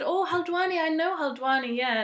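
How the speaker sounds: surprised dentist. Retold or imitated. Dentist recognizes place.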